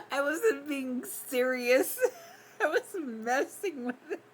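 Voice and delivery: giggling through words; laughing. Real, not acted. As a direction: laugh while you say it. Laughing while explaining.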